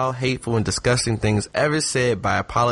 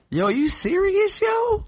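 much higher, shocked falsetto; incredulous squeal; slower than usual. Retold or imitated. Host reacts to Trump's tweet, shocked high falsetto. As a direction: squeak out the disbelief. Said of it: "And I was just like".

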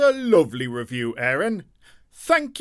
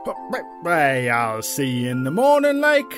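character voice; 8 semitones lower, 3 dB louder than usual. Acted.

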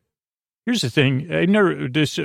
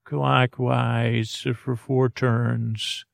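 slow, soft relaxation guidance; soothing sleep-coach voice; guided relaxation; 2 semitones lower, 5 dB quieter, much slower than usual. Acted. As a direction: count them gently through each turn, unhurried. Guides a slow jaw relaxation exercise.

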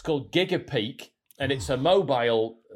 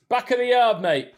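loud, higher blunt worker; shop worker voice; 9 semitones higher, 5 dB louder than usual. Pretend. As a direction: call it across the yard. Voicing shop worker reply.

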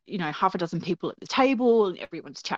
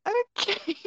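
very high, giggly okay no worries; laughing retreat; 11 semitones higher than usual. Retold or imitated. Child backing away from mum, high sheepish laughing. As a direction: back off sheepishly, laughing through it.

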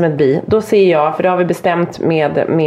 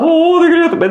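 acted thrilled cry; excited call back; 11 semitones higher, 3 dB louder than usual. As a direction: imitate a kid yelling while skiing. Child calling back while skiing.

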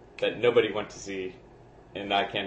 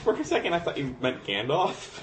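ordinary talk

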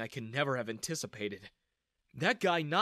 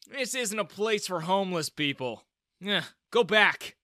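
sneering; 5 semitones higher, 5 dB louder than usual. A narrator's reading. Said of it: "he sneered".

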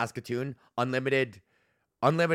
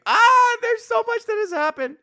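very high, loud exasperated cry; overwhelmed exclaim; 8 dB louder than usual. Real, not acted. Overwhelmed by the backlog of stories, high loud outburst. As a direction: burst out at how much there is to say.

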